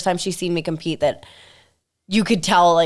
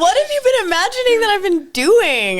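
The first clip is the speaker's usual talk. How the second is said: amused disbelief; 13 semitones higher, 3 dB louder than usual. Real, not acted. Laughing shock at mom's questions.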